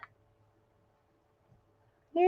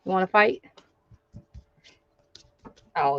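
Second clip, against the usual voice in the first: ordinary delivery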